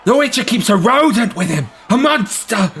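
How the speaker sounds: high shouted alarm; alarmed crowd man. Acted. Crowd member about the Witcher's pet, high alarmed shout. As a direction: recoil and warn.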